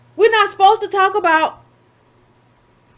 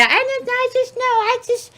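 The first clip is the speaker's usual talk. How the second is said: mocking Stacey Abrams; 7 semitones higher than usual. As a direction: pitch it up and sweeten it like Stacey Abrams. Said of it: "You got Stacey Abrams out there".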